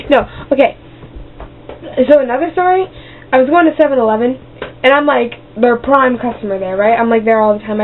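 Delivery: ordinary delivery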